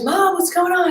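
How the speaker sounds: put-on high, calling kid's voice from upstairs; kids calling. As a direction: call down like a worried kid. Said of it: "My kids are screaming upstairs".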